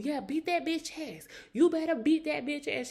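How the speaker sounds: high mock hype, cheering a fight on; lightly hammed-up hype voice; egging on a friend. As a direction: imitate the friend shouting encouragement. [name] imitating friend egging on.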